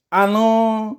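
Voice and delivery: put-on high old man calling back; nasal old man. A narrator's reading. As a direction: answer from the next room in a high nasal voice. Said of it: "नाकात बोलणारा".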